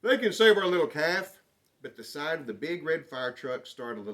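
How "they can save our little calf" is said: loud, high panicked shout; urgent shout for the farm hands; farmer shouting. Acted. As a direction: yell for help across the field. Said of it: "the farmer shouted".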